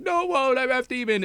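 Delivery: very high-pitched objection; mock protest. Rumi argues back with the girl, mock protesting. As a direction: wail it lightly as an upset girl.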